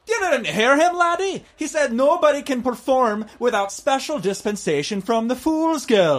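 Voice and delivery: high gruff dwarf, laddie-style. Acted. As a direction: squeak it out like a cocky dwarf. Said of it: "the dwarf says".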